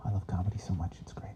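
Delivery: soft, breathy whisper to himself; whispered aside. Real, not acted. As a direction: whisper it like a defeated confession. After sigh, whispered aside.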